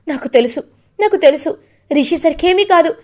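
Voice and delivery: rising relief that peaks on ఏమీ కాదు; emotional relief. Retold or imitated. Vasudhara telling Mukul she knew Rishi was safe, relief building to a cry. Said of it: "అని బాధపడుతూ ఉంటుంది".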